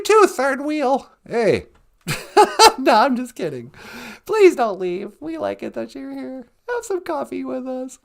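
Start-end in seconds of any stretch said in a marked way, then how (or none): none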